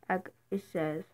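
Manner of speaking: ordinary delivery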